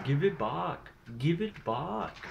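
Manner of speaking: high, slow, drawn-out mock yell; mock scream. Telling of an imagined clerk chasing friends, hammed-up screaming. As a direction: imitate a clerk screaming after thieves. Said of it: "running out with a shotgun screaming".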